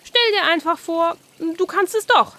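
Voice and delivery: lightly voiced carp passing on a lesson; the carp telling Oskar to just imagine he can; carp's advice.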